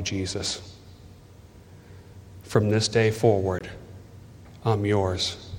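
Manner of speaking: slow, measured prayer in short phrases; deliberate, spaced-out prayer of commitment; sincere led prayer. Real, not acted. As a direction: lead a prayer phrase by phrase, leaving room to repeat.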